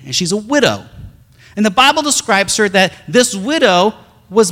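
loud, high, impassioned sermon voice with slow, drawn-out stretches; preaching at full force. Acted. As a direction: pour it out to the congregation like a revival preacher. Retelling the widow's story, preaching.

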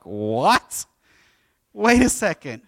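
incredulous double take, played big; mock disbelief. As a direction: act out being stunned by a trick. Jacob discovering the trick, acted.

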